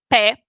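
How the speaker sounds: "pe" said properly with a clear e vowel; proper pronunciation. Shown as a demonstration. Explaining Bucharest speech to listeners, "pe" said properly with e. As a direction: say "pe" with a full open e, as in pet. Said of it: "the proper pronunciation is literally pe".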